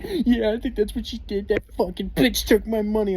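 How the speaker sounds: high, indignant rant of a jilted buddy; bitter friend. Retold or imitated. Friend cursing the woman.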